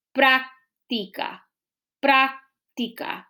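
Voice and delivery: práctica said slowly, split after prác; drawn-out práctica with a gap inside the word; syllable by syllable. Shown as a demonstration. Teacher asking where the word separates, syllable by syllable. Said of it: "la separación de las sílabas de esa palabra".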